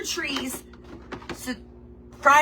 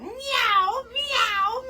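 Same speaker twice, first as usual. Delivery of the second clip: high playful cat meow; 10 semitones higher than usual. Pretend. Cat Rowan wanting the baby chickens, playful high meow. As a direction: meow like naughty kitty Rowan. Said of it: "Just call me Rowan".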